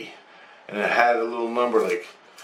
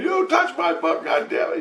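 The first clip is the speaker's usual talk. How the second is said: mock angry Jesus. Jesus snatching book, scolding.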